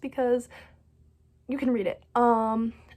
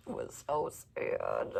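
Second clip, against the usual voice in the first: mock sad; 9 dB quieter, slower than usual. Real, not acted. Repeated, dropping low and soft.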